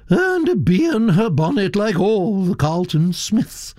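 put-on high, gossipy old aristocrat voice, pitched well up; elderly Duchess. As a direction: chatter on like a grand old lady.

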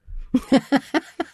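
very high run of laughter; high, real giggling; delighted laugh. Real, not acted. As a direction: laugh hard at the absurd story. Laughs at Superman story.

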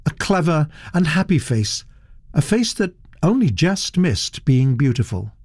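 plain reading voice

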